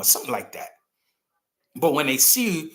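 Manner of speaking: usual speaking voice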